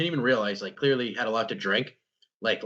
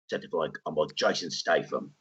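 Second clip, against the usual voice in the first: low, gruff, deep Statham voice; Jason Statham impression; 9 semitones lower than usual. Shown as a demonstration. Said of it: "changing my voice".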